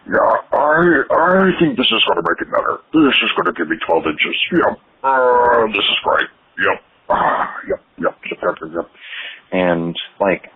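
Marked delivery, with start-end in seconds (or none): 0.1-8.9 high, earnest mock believer voice; mocking men doing jelqing, pitched up and earnest; jelqing guy. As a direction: play a guy sure it's working. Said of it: "Just being like".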